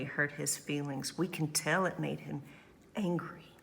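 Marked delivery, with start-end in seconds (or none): none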